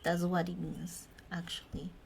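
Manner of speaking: whisper. Real, not acted. Whispered aside.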